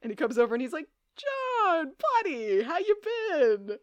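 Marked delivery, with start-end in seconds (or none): 1.2-3.8 bright, mock-friendly greeting pitched higher; sarcastic welcome. Damon greeting John with fake warmth, sarcastic. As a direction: greet him like an old pal you can't stand. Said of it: "the warmest welcome ... is a sarcastic one".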